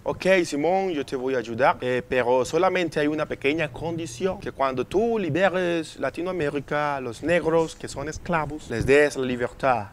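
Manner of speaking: put-on Spanish with a French accent. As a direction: speak like a Frenchman speaking Spanish. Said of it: "ellos hablaban con acento francés".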